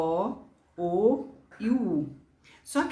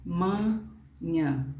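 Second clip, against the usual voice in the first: drawn out syllable by syllable; teacher spelling amanhã out in slow breaths; slow demo word; much slower than usual. Shown as a demonstration. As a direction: stretch amanhã out slowly, "a-ma-nhã". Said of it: "Então, por exemplo".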